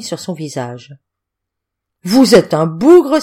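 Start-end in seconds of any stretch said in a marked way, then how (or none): 2.0-3.2 put-on loud, slow, delighted drunken shout; drunken cry. A narrator's reading. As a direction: hail him, tipsy and thrilled. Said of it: "s'écria-t-il". (cri d'ivrogne ravi, fort et lent)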